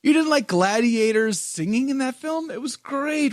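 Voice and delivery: high-pitched incredulous protest; hammed-up indignation on the musical's behalf; mock outrage. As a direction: object in playful disbelief, pitched well up. Mock outrage at viewer's opinion.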